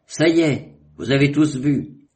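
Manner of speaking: high, loud, triumphant and final; Détemps loudly claiming victory before the captains; peremptory. A narrator's reading. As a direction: declare it settled, no argument allowed. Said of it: "dit-il d'un ton péremptoire".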